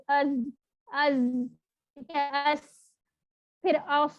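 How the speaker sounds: از and اس with a clear buzzing and hissing end; teacher demo of از and اس; articulation demo. Shown as a demonstration. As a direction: model the sounds for practice. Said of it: "جیسے آپ بول کے دیکھیں".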